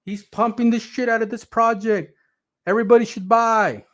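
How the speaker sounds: high, excited mock fan voice; mocking hyped fans. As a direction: squeal it like a hyped crypto follower. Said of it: "Everybody's saying".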